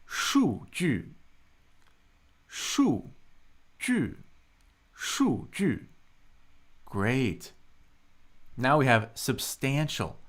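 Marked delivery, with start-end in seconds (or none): 2.5-5.9 数 and 据 each start high and drop sharply; 数据 as two steep high falls, one beat apart; two fourth tones. Shown as a demonstration. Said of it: "That's two fourth tones".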